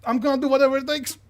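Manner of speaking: loud, high, fast outburst; defiant guard. Acted. Spear guard defies the gunman, loud and desperate. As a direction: burst out with desperate resolve.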